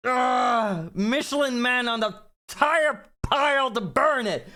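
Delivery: screeching, high-pitched rage at the game; frustrated high shout. Real, not acted. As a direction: yell it high and furious, like you almost had it. Raging at a failed boss attempt.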